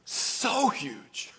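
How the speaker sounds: high, lightly voiced driver; a hint of an awed driver; mock driver echoing his wife; driver agreeing. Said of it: "The driver then leans forward and says".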